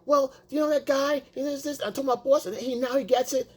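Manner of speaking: high, chatty female imitation; mimicked wife. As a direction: tell work gossip in a put-on wife voice. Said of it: "So she comes, she says".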